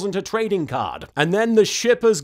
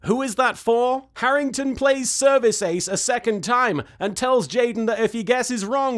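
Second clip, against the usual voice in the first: ordinary delivery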